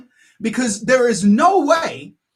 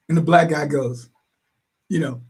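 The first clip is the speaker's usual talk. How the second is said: ordinary talk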